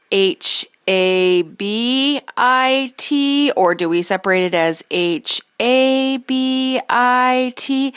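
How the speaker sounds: H A B I T spelled slowly and loudly, letters grouped; spelled out split. Shown as a demonstration. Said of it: "How do we separate it".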